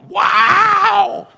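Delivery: long, high, loud wail; imitated wild scream. Preacher imitating a woman going off, screaming. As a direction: let out a sustained screech.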